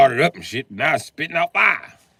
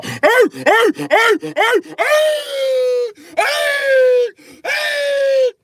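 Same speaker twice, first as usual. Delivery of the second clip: rising higher and louder with each hey; yelling at dog; 5 dB louder, much slower than usual. Acted. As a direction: shout down the barking dog, climbing up. Owner yelling at barking dog.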